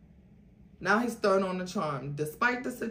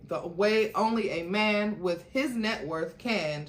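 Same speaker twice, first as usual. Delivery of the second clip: plain reading voice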